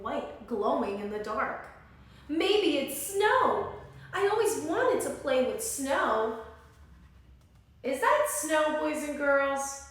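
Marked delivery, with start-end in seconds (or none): 2.3-6.4 high, loud, slow delighted cry; a little bear amazed by snow; thrilled bear. Acted. 7.8-9.8 louder, higher question. Real, not acted. Reader quizzes children.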